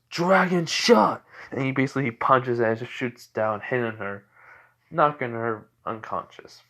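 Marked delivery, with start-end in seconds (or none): 0.1-1.2 slow, loud, high battle cry; attack shout. Retold or imitated. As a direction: yell the move's name like an anime hero. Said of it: "And he goes".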